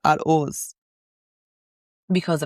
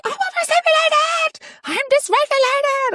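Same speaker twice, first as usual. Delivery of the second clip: a shrill mock meltdown, pitched way up; mock screaming. Telling how she screamed at friends when overwhelmed, hammed-up shriek. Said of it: "I'm, like, screaming and shouting".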